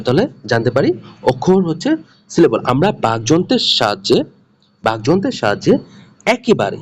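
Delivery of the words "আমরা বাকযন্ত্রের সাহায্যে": ordinary talk, nothing marked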